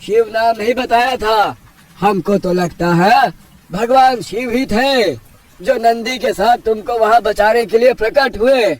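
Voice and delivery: high, emphatic uncle voice; village elder marvelling. Acted. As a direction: declare a divine revelation to the village.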